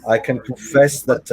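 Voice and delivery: ordinary delivery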